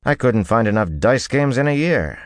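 high, exasperated protest; demanding. A narrator's reading. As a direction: push the pitch up in complaint. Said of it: "he demanded".

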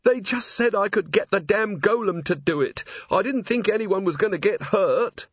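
plain reading voice

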